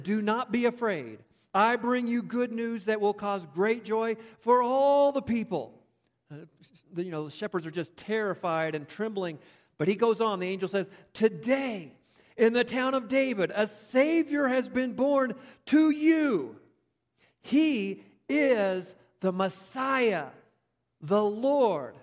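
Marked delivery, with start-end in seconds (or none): 0.0-5.8 angel's announcement. Acted. 11.1-21.9 high, loud and slow heralding; a loud, slow proclamation of great news; angel's proclamation. Acted. Angel proclaims Messiah's birth.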